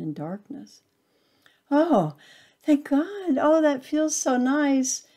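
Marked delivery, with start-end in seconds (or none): 1.7-5.1 high, louder sigh of relief; relieved delight. A narrator's reading. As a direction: melt into the sun's warmth. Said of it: "it says to itself".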